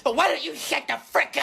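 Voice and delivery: angry snap. Acted. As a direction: bite his head off. Player snaps at opponent.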